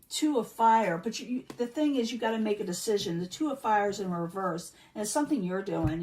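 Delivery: ordinary talk, nothing marked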